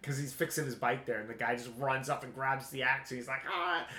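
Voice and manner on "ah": mock startled yell; high-pitched pretend yelp. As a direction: act out a man caught by surprise. Startled guy grabbed for axe.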